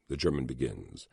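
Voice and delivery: ordinary delivery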